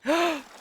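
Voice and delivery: sudden high gasp of surprise; sharp excited gasp. Real, not acted. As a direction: gasp as the catch appears. New bar catches a fish.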